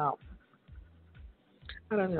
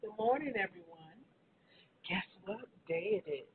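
usual speaking voice

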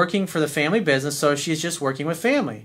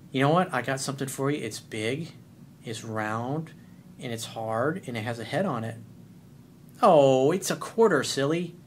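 lower, softer sly setup; teasing innuendo; 2 semitones lower, 4 dB quieter than usual. Pretend. Coach telling a teasing joke, low and sly. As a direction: lead her on with a sly tease.

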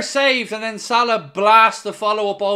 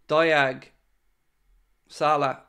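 ordinary talk

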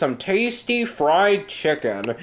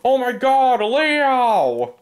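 shocked cry; 7 semitones higher, 3 dB louder, slower than usual. Acted. Guest hit by chicken.

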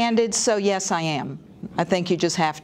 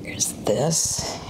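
whisper; 3 semitones lower, 6 dB quieter, slower than usual. Real, not acted. Muttering to herself.

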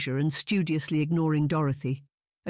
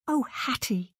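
high, upset cry of her sister's name; distraught cry; 9 semitones higher, slower than usual. A narrator's reading. Younger sister runs to Hattie, distraught high cry. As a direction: call out in distress.

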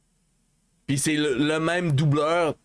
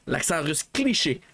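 ordinary talk

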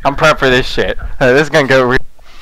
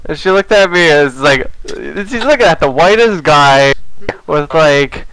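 ordinary delivery

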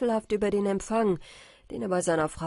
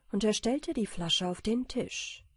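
ordinary delivery

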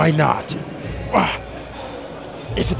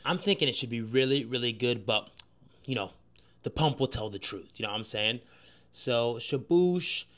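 quiet talk; 3 semitones lower, 8 dB quieter than usual. Real, not acted. Quieter talk after the set.

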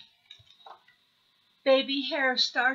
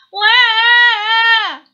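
put-on very loud, high baby bawl; acted toddler wail at full volume; hammed-up howl; 12 semitones higher, 17 dB louder than usual. A narrator's reading. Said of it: "howled Baby Hare".

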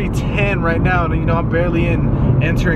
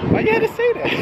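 shocked; faster than usual. Real, not acted. Mock outrage at friend's joke.

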